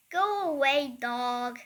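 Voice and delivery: very loud, fierce bellow; Henry howling at the dog to save Peter; horrid howl. Acted. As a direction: roar to scare the dog off. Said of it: "he howled in his most horrid voice".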